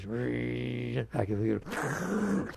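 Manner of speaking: a long, slow mechanical drone in an odd voice; imitated vacuum whirr. Teacher mimicking household appliances for the audience, slow droning hum. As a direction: drag it out like a vacuum running. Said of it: "The blender goes".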